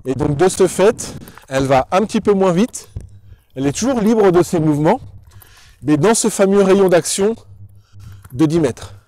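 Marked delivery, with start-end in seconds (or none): none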